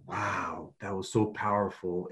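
soft, low imitation of awe; hushed awe. Coach retells client's reaction, quiet and awed. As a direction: say it quietly, as if moved.

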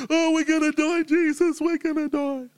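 high, hammed-up panic; panicked disciples. Disciples waking Jesus in the storm, high mock panic. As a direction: wail it like terrified fishermen.

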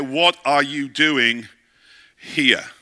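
slow, drawn-out "What are you doing here?", each word weighted; slow emphatic question. Acted. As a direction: lean on every word, slowly. God asks Elijah, emphatic repeat.

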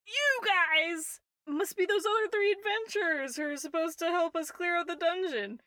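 high-pitched tipsy bard; drunk bard. Acted. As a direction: hail the other adventurers as a drunk would. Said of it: "very clearly drunk, the lanky-looking fella".

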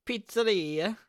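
high-pitched hammed-up Italian word; mock Italian accent. Host offering another Italian word, mock Italian accent. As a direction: sing it out with a mock Italian lilt. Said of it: "That's Italian, isn't it?".

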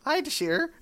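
put-on very high old lady greeting; granny voice. Granny greeting her granddaughter Dashir in a very high voice. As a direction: pitch it way up as granny. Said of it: "no longer remember what Granny sounds like".

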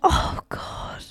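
whispered, frightened gasp of words; scared whisper. Pretend. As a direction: breathe it out in mock terror. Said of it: "Why do you sound so scared?".

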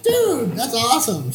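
very high, thrilled squeal; retold excitement. Guest retells hearing his name on the news, excited. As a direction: imitate yourself being delighted, pitch shooting way up.

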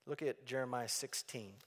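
ordinary delivery

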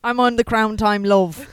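loud put-on British accent; fake British accent. Echoing friend's British bit, fake British accent. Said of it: "my accent's fake".

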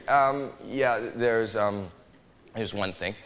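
low hesitant mutter; sheepish mumble. Retold or imitated. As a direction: mumble it like a shy patient owning up. Sheepish confession to the doctor.

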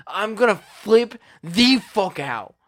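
building up to losing it; heated outburst. Real, not acted. Threatening meltdown over thermostat setting.

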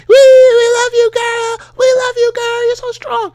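very high, loud squealing fan voice; hammed-up fangirl shrieking support; mock squealing fan. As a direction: squeal it like a gushing fan in the crowd. Mocking shrill crowd fans.

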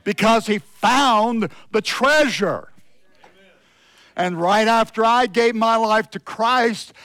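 loud, high, fervent preaching. Acted. Pastor preaching to the church, fervent and loud. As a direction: preach it with full force to the congregation.

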